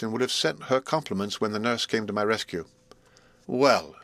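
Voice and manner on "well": nurse's exclamation. A narrator's reading. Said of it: "she cried".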